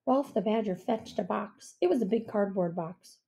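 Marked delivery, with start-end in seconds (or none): none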